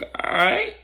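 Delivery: high, questioning, rising "all right"; hesitant yes that lifts sharply at the end; unsure agreement. Retold or imitated. Victor agreeing to have Brandon's back, unsure. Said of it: "he was unsure".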